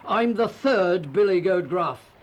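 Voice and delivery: mild bellow from the biggest goat; bellowing big goat. A narrator's reading. Eldest goat announces himself to the troll, mildly bellowing. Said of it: "bellowed the eldest billy goat Gruff".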